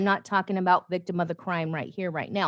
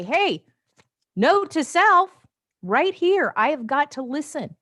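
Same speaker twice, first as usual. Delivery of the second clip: higher, louder playful self-reminder, "note to self" pitched up; mock note to self; 8 semitones higher, 5 dB louder than usual. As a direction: call it out like a reminder to yourself. Acted note to self quote.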